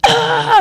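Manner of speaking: high-pitched imitated scream; short high cartoonish shriek; mock scream. Imitated horrified scream in story.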